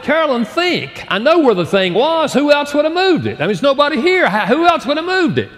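high, insistent, exasperated accusations; exasperated accusing. Retold or imitated. Husband accusing wife of moving briefcase, exasperated. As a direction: hammer her with it, exasperated.

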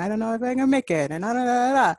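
a voice tightening up in panic before a high note; anxious high notes. Retold or imitated. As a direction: fret about making the high note. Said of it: "if this is what you're telling your body".

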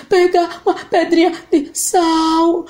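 voz de menino, alta e lenta; boy voice. Acted. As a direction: menino respondendo de longe. João. (voz de menino)

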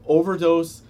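overdose said high and loud; stress on overdose. Real, not acted. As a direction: punch the word overdose. Correcting the customer.

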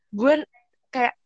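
ordinary talk

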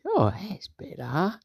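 high, slow, drawn-out relief; acted, pleased little exclamation; pleased character. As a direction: lift it high and stretch it out. Character pleased with his repair.

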